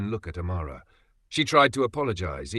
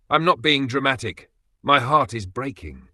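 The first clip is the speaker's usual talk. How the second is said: higher, louder anguished protest; desperate protest; 5 semitones higher, 5 dB louder than usual. Acted. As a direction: cry it out, hurt and pleading. Wife protests through heartbreak.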